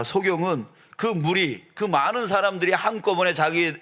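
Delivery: ordinary delivery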